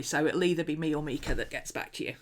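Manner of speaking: ordinary talk, nothing marked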